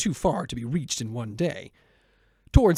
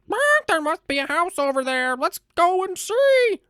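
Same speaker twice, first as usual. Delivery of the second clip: high, loud rooster call; 6 dB louder than usual. Acted. The rooster calls down to his friends, high and loud. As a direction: sing out from the treetop like a rooster.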